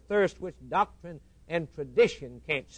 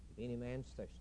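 hushed echo; 3 semitones lower, 13 dB quieter, much faster than usual. Acted. Preacher echoing Christ's invitation in a hushed voice. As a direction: drop to a near hush, lower and much softer.

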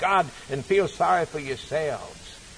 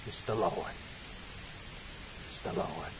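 low, very soft repeat; hushed awe; 9 semitones lower, 15 dB quieter than usual. Real, not acted. Preacher to congregation, repeating in hushed awe. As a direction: drop to an awed hush.

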